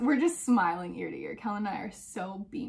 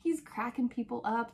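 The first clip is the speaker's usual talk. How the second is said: ordinary talk, nothing marked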